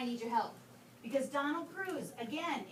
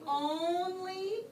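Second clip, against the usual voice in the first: "only" drawn out slowly, pitched up in a question; stress on only; 5 semitones higher, 5 dB louder than usual. Shown as a demonstration. Teacher asking class about the wording, stress on "only". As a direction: stretch out "only" and lift it. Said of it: "stress the word only".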